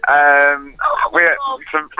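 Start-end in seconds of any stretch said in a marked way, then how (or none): 1.1-2.0 squealing laugh while tickled; breaking up, laughing high; being tickled. Real, not acted. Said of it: "They're all attacking me".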